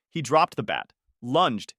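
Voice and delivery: usual speaking voice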